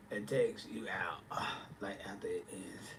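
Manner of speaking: whispered aside about exhaustion. Real, not acted. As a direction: drop to a confiding whisper. Confiding how performing drains her.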